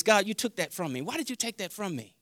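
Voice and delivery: soft, low, subdued plea; hushed questioning. Retold or imitated. Preacher questioning God about losing his gift, hushed. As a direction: ask God quietly, almost privately. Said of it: "why would I say it like this".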